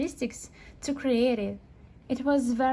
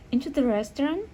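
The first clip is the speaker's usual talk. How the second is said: ordinary talk, nothing marked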